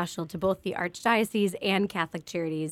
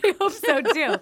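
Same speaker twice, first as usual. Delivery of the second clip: laughing; 10 semitones higher, 5 dB louder than usual. Real, not acted. Laughing reply.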